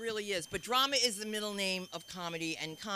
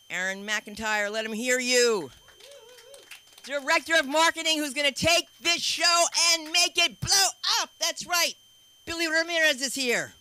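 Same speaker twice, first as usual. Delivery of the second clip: loud, high emcee call to the crowd; hype announcer; 7 semitones higher, 11 dB louder than usual. Real, not acted. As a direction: pump up the room, shouting the names. Host hyping crowd for staff.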